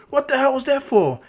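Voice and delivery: high, loud angry protest; outraged rival's yelp; indignant outburst. Acted. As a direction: protest loudly, voice shooting up. Rival reacts to being flicked.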